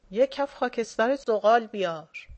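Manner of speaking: loud shouted order; shouting. A narrator's reading. Dashakol shouting to the house for ash, loud. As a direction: call out for ash. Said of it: "داشاکل داد زد".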